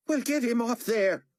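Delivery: furious high shout; enraged order; raging. A narrator's reading. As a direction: rage at him to get it off. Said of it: "Carpathia raged".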